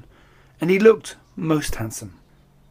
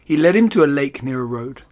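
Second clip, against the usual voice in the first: ordinary delivery, nothing marked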